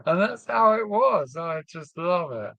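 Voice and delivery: usual speaking voice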